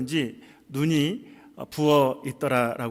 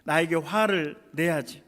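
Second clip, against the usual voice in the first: ordinary talk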